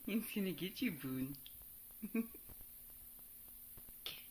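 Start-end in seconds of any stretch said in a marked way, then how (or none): none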